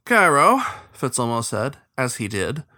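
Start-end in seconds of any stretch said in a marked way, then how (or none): 0.0-0.6 loud, high call across the deck. Acted. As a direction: call his name across the boat. Captain calling out to the heron.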